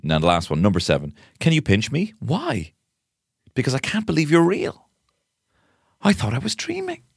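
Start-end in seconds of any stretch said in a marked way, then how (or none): 1.4-7.0 high, put-on wonderstruck voice; hammed-up romantic awe; dreamy chat-up act. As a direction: play starry-eyed disbelief, pitched up high. Acts out pinch-me chat-up line.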